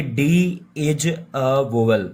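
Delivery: drawn out, slow statement; slow deliberate claim. Shown as a demonstration. Teacher slowly states the false claim, drawn out. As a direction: spell it out slowly. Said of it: "अगर मैं आपसे को ऐसे कहूँ".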